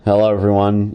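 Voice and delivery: ordinary talk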